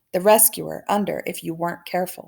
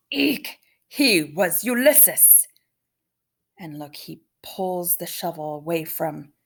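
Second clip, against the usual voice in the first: usual speaking voice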